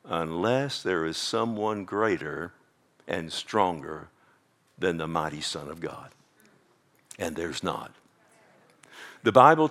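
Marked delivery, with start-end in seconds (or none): 0.0-7.9 hushed emphasis. Real, not acted. Preacher hushing the church on God's might, low and soft. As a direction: drop to a hush to land the point.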